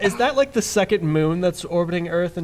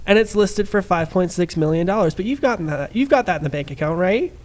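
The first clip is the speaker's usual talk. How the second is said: put-on Valley Girl voice with upspeak on each question; much slower than usual. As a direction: play a Valley Girl showing off a house. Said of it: "I can do a pretty good Valley Girl impression".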